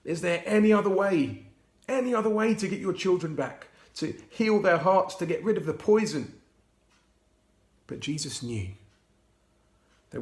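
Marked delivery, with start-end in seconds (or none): 0.0-6.4 pleading. A narrator's reading. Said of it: "Jesus cried".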